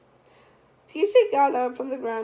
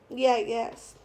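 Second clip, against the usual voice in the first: usual speaking voice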